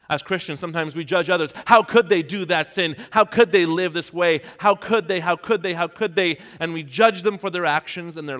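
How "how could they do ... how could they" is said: loud, indignant mock accusing, pitch up; judgmental outrage. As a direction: voice the self-righteous judge, a touch hammed-up. Acting out judgmental Christians.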